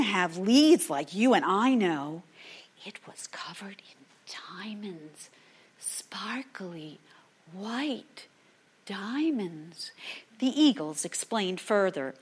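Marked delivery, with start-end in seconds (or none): 2.8-9.9 awed whisper. A narrator's reading. Storyteller whispering about the diamond tree, awed. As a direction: hush as if revealing treasure.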